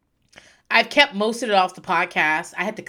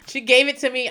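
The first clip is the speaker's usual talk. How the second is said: normal talking voice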